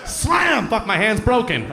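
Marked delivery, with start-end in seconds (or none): 0.0-0.7 mock slam. Mimes hanging up.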